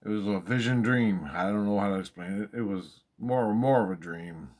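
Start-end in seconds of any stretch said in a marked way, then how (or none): none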